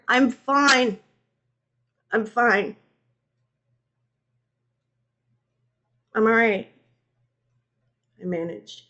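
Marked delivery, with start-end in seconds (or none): 0.1-6.7 raised voice easing down; unconvincing reassurance. A narrator's reading. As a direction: insist you are fine, unconvincingly. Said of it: "It wasn't convincing in the slightest".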